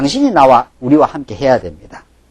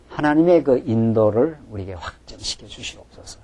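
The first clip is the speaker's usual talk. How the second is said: hushed prayer; 5 semitones lower, 9 dB quieter than usual. Acted. Soft prayerful close.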